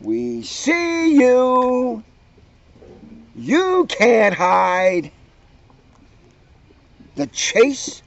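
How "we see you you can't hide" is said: high, slow taunting sing-song; jeering. A narrator's reading. Leona jeering at the hiding kids, slow taunt. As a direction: taunt them slowly, like a bully. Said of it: "Leona's jeering voice rang out".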